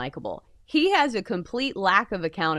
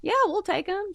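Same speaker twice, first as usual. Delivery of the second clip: mock eager fan; 11 semitones higher than usual. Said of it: "I'm one of those bottom feeder people".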